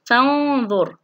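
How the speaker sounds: فانظر with long nasal noon; ikhfa demonstration. Shown as a demonstration. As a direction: hum the noon before the ظ of فانظر. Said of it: "النون الساكنة والحرف في كلمة واحدة". (إخفاء النون بغنّة ممدودة في فانظر)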